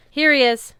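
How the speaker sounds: loud, high call. A narrator's reading. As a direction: call across the rubble. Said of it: "Sam called".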